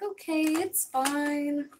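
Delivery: slow, strained "okay, it's fine"; forced calm. Retold or imitated. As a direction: act fine through clenched patience. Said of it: "trying real hard to just be like".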